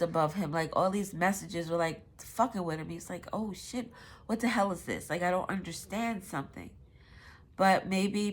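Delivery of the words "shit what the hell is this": ordinary talk, nothing marked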